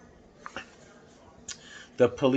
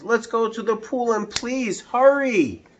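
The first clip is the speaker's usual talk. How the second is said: urgent yell; 11 semitones higher than usual. Acted. Boy urging the firemen, high and urgent. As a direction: push them to hurry. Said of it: "I yelled".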